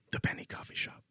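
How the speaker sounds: breathy whispered sponsor name. Pretend. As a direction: hush the sponsor name like a secret. Announcing the sponsor.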